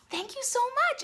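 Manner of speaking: very high-pitched quoted thanks; gushing thanks. Retold or imitated. Magazine owner thanks her for the praise, imitated gushing. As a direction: imitate someone overcome with gratitude, pitch leaping up.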